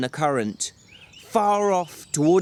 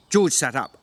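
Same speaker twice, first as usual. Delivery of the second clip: ordinary delivery, nothing marked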